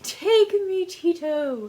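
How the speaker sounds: mock-swoon over a handsome man; swooning girl. Girls swooning over Tito, calling mock-lovestruck. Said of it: "all the girls are just like".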